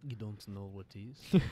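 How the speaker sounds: slow mimicked Russian accent. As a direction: question it slowly as a mock Russian. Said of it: "Russian stereotype".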